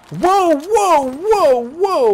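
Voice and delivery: high, loud startled whoas; alarmed whoa. Real, not acted. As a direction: yelp it as the zombies rush in. Sudden danger in the game.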